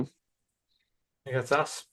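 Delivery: drawn-out soft repeat; slow, quiet drawl. Acted. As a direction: stretch it out quietly.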